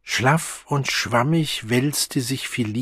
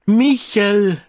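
higher, louder call; 9 semitones higher, 6 dB louder than usual. A narrator's reading. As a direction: call through the door. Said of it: "rief sie zur Tür hinaus".

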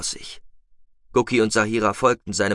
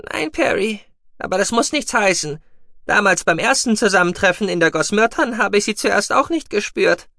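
high, loud piping creature voice; mousebeaver answering; 11 semitones higher, 5 dB louder than usual. A narrator's reading. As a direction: protest in a high little voice. Guki figure answering. (hohe, kräftige Mausbiberstimme)

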